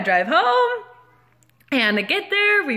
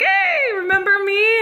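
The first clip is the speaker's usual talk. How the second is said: very high, singsong hello; fake-friendly greeting; 9 semitones higher than usual. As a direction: greet him in an over-sweet high voice. Confronts clerk with fake friendliness.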